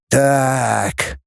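long, drawn-out pondering word. A narrator's reading. Teacher rubbing his chin over the news, drawling. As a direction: stretch the word, trailing softly. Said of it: "протянул Воронин".

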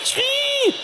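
high mechanical whine; mock machine squeal; VCR squeal. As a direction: imitate the shrieking recorder. VCR noise.

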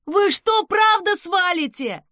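high, loud, dismayed yell; wailing outcry. A narrator's reading. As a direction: cry out as the rescuers leave. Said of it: "возопил он из могилы".

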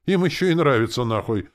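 outraged sergeant, starting high and loud; rant, then resume drill commands; cursing parade-ground outburst; indignant. A narrator's reading. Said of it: "возмутился Валера".

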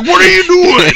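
mock startled cry. Staff startled by the patient pulling at his tube. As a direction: yelp it in disbelief.

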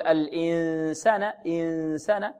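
الإنسان with the noon pressed in the mouth; wrong mouthy noon. Shown as a demonstration. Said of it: "هذا خطأ". (نطق خاطئ للإخفاء)

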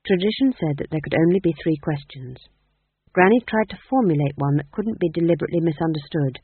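ordinary delivery, nothing marked

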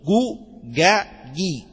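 جو، جا، جي as separate syllables, u then a then i; jim vowel drill. Shown as a demonstration. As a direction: spell out جو، جا، جي slowly one by one. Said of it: "بفتح الجيم وكسرها وضمها".

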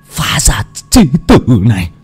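furious hiss through the teeth, bursting loud; rít qua kẽ răng. Acted. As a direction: spit the curse at the greedy son. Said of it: "rít qua kẽ răng". (người cha rít lên giận dữ qua kẽ răng)

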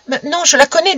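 put-on high, anxious child's voice; nervous lying boy. A narrator's reading. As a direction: insist timidly to the gendarme. Boy lies hesitantly to gendarme.

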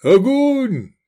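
high, loud military order; barked command. A narrator's reading. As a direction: shout the order to fire. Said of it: "скомандовал Акон".

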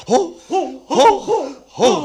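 high ringing hurrah calls; festive cheer. Acted. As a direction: lead the hall in a cheer. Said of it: "mit mir in den Ruf einstimmen".

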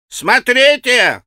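high, loud, long-drawn call; warning shout. A narrator's reading. Boys calling after Alyosha, drawn-out warning shout. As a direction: call after him in a stretched boyish yell. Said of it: "кричали ему вслед предупредительно".

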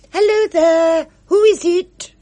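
high, loud call into the dark. A narrator's reading. Pinky calls to someone in the garden, loud and high. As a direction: call out to a stranger.

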